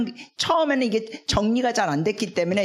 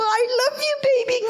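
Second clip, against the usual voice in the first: much higher, sweet mock-romantic coo; lovey-dovey couple; 12 semitones higher, much slower than usual. Wife cooing to her husband, hammed-up high lovey voice. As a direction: ham up the sappy newlywed voice. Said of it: "막 이러고 그냥".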